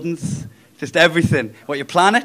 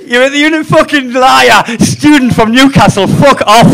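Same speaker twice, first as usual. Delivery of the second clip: mock outraged shout; 4 semitones higher, 7 dB louder than usual. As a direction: bellow it in fake outrage. Mock outrage at a Geordie student.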